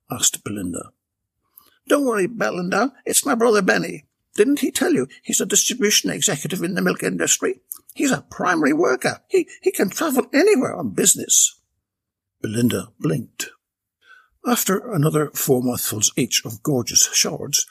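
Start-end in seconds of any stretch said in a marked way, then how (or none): 1.9-11.6 higher, reassuring chatty woman; Bella. A narrator's reading. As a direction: brag about her brother. Bella voiced in story.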